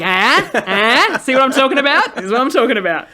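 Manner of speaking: loud, high, eager outburst; excited friend. Retold or imitated. Friend shows off a song they love, excited. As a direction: crow it with mounting delight.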